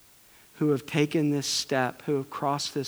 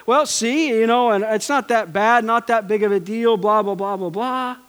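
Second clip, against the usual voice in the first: higher, louder mock defensive babble; defensive whine; 7 semitones higher, 7 dB louder than usual. Preacher mocking a defensive spouse, higher defensive whine. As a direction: brush it off in a hammed-up whine. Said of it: "we get defensive ... we're just like".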